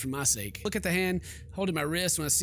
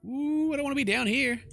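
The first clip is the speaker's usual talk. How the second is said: nervous dread; 9 semitones higher than usual. Real, not acted. Player dreads going into cellar.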